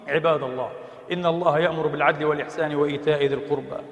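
low, soft formal recitation; formal sermon close. Acted. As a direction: settle into the ritual closing words. (خاتمة الخطبة بصوت هادئ منخفض)

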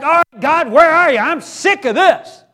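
venting at God in the middle of the battle; frustrated outburst at God. Retold or imitated. Pastor voicing his own upset prayer, loud and exasperated. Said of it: "I get upset".

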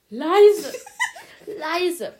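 höher und deutlich lauter, mit einem Lacher dazwischen; gespielt mahnend. Retold or imitated. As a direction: halb ernst, halb lachend ermahnen. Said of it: "fünfzehn bis achtzehn Mal sage ich". (zur Freundin nachts, laut und lachend ermahnend)

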